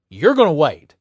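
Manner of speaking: loud, firm scolding; retold scolding. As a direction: put the rude customer in his place. [name] scolding rude customer.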